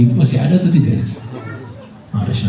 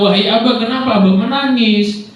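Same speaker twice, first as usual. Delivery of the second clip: put-on child's question; 8 semitones higher than usual. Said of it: "Ditanya sama anaknya".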